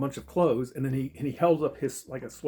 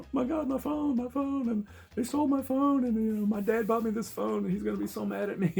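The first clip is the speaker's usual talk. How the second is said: high, whiny imitation of a panicked girl; panicking; 12 semitones higher, 3 dB louder than usual. Girl whose phone was stolen, hammed-up panic. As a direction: wail it far above your own pitch, in mock panic. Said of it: "she just stands there and panics".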